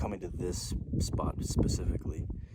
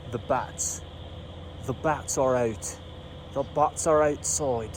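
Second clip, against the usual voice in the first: higher and louder, thrilled; pointing out the bats with real delight; real, excited exclamation; 5 semitones higher, 4 dB louder, slower than usual. Real, not acted. Sudden thrill at seeing bats.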